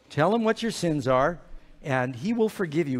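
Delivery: ordinary delivery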